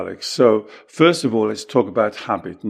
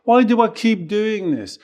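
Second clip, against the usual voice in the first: high, whining mock complaint; mocking a client who feels helpless; mock whiny victim; 10 semitones higher than usual. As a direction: parody a helpless self-pity voice, much higher. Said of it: "Do you say this?".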